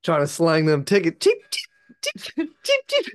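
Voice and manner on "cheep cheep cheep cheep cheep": bird chirps. Retold or imitated. Hawking tickets, imitated chirping.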